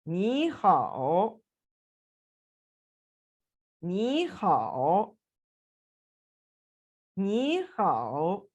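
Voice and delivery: very slow नी हाओ with नी rising like a second tone and हाओ dipping; tone sandhi ni hao. Shown as a demonstration. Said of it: "तो कैसे बोलेंगे जी?".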